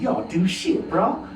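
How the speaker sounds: lightly voiced teen gangster slang; wannabe thug talk. A kid showing off his tough street talk. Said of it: "I actually talked like this back".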